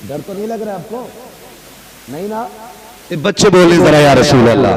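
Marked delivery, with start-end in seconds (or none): 3.1-4.7 loud call that falls at the end; rallying call. Real, not acted. Preacher urging the children to chant, rallying call.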